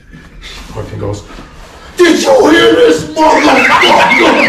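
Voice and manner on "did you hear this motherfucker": very high, drawn-out mock scream; full-volume, scandalized outcry; outraged shouting. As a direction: holler in hammed-up disbelief. Drunk player's outraged outcry.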